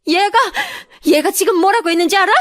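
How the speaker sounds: high, loud, hurried accusation; outraged tattling. Acted. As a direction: denounce her in shrieking indignation. Mother-in-law reports the wish, outraged.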